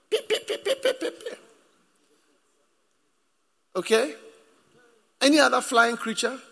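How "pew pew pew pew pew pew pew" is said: high-pitched rapid pew-pew noises; squeaky mock zapping sounds; imitated attack noise. Shown as a demonstration. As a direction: mimic tiny darts firing in quick succession. Imitates flying creatures attacking the listener.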